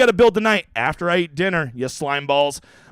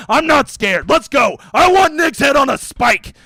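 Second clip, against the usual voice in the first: louder, higher mock-tough taunting; false bravado; 6 semitones higher, 5 dB louder, slower than usual. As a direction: puff up and taunt, half joking.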